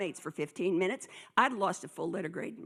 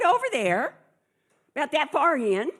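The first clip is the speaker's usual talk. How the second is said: emphatic pointing; 7 semitones higher, 8 dB louder than usual. Retold or imitated. Retold answer to teacher.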